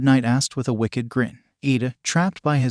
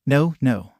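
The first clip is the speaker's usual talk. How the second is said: ordinary delivery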